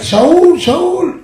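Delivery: high, loud call across the hills. Retold or imitated. King David calling to Saul from a mountain, shouting. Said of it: "מגיע דוד המלך לאיזה הר וצועק".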